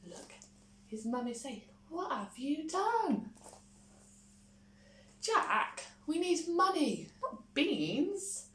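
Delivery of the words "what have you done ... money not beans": crying out. A narrator's reading. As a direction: scold him in dismay. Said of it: "cried his mother".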